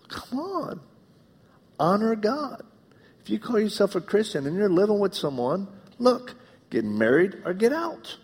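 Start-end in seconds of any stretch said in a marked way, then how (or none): 0.1-4.7 exasperated plea. Retold or imitated. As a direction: plead with them, almost pleading. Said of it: "I'm like".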